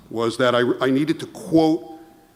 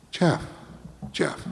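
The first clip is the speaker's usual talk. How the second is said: soothing; 3 semitones lower, much slower than usual. Retold or imitated. Partner soothing with arm around.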